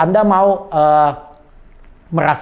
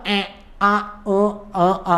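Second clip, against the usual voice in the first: hesitant filler noises; 4 dB quieter than usual. Shown as a demonstration. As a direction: stall between vowels, searching for words. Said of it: "jangan suka".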